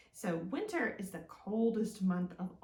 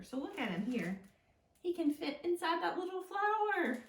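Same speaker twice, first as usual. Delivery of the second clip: put-on story reading to children; 3 semitones higher than usual. Librarian reads Old Bear to preschoolers in a storytime voice. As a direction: read the picture book aloud with gentle wonder. Reads picture book aloud.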